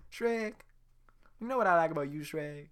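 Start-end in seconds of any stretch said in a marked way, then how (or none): 0.1-0.5 Donkey voice. Acted. Donkey saying name.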